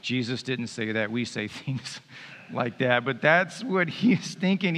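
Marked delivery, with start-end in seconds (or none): none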